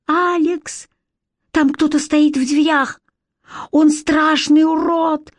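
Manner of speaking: high, slow, shaky with fright; frightened boy, voice trembling. A narrator's reading. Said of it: "дрожащим голосом сказал Коннор".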